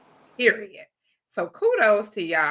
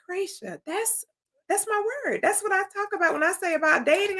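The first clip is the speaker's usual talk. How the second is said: high-pitched, excited claim; thrilled high exclamation; 11 semitones higher, 3 dB quieter than usual. Retold or imitated. Telling Gracia the word is hers, high and excited. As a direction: squeal that it's your word.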